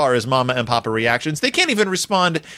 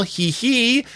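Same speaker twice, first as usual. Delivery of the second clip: higher-pitched mock doll giggle; giggling doll; 7 semitones higher than usual. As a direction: voice a toy's tee-hee. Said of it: "goes the doll".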